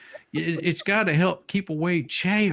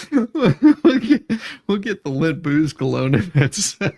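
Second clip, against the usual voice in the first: laughing; 3 semitones higher, 6 dB louder than usual. Real, not acted. Laughing while pitching product.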